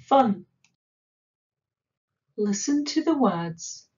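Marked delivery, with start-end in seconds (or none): none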